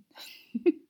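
quick high coy laugh; high playful giggle. Acted. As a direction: giggle it off sheepishly.

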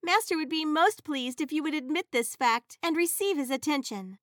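high, sweet genie voice. Acted. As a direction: play the eager magic servant. Genie voice to master.

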